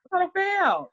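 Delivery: hammed-up kid complaint; imitating anxious kids. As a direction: pitch it way up like worried kids. Voicing her panicking straight-A children.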